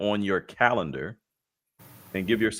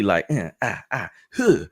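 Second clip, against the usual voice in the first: martial arts kiai. Pretend. Karate blocking moves, Bruce Lee.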